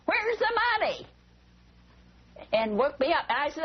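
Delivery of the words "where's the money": imitating an intruder's high-pitched yell; mock yell, pitched way up; retold yell. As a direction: act out a burglar's shouted demand. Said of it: "And she yelled".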